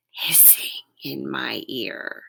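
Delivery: soft, slow snake-like hiss. Acted. Reader voicing the boa constrictor line for children, hissing. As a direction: draw it out softly like a snake.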